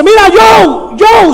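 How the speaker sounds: high shouted call to a stranger. Retold or imitated. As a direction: yell the name across the tent. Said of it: "qué es lo primero que dice".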